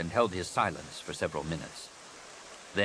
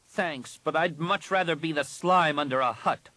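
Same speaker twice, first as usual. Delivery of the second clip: sneering; 9 semitones higher, 4 dB louder than usual. A narrator's reading. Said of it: "the Jedi sneered".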